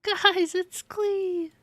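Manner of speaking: high, drawn-out delighted squeal; excited squeal. Real, not acted. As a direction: squeal the name to your audience. Fan greets favourite character's appearance.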